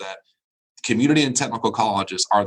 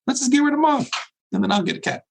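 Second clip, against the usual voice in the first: much higher, mock-innocent kid reasoning; mock child logic; faster than usual. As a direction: play the kid's cheerful logic.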